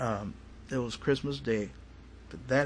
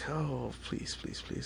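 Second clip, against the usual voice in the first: much softer, retold plea; soft pleading; 8 dB quieter, slower than usual. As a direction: drop the volume for a small 'please, please'.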